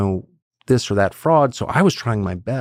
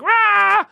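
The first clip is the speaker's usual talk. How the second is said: high, sharp cry of rage; frustrated roar; 4 dB louder than usual. Retold or imitated. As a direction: let out a high frustrated rah. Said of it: "And I was like".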